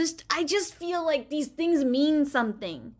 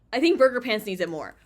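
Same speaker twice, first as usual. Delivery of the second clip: ordinary talk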